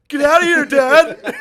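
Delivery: high, loud yell at dad; hammed-up yell, high and loud; mock yell. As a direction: shriek him out of the room. Yelling at dad walking in.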